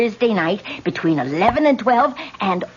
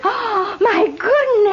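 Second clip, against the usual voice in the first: shocked gasp; 10 semitones higher, slower than usual. Acted.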